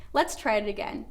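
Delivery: ordinary talk, nothing marked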